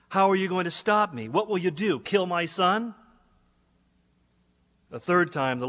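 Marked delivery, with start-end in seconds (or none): 0.1-2.9 mocking taunt, pitched higher; arrogant challenge. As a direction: dare God, scornful and arrogant. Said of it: "I responded with arrogance".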